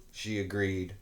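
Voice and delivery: ordinary delivery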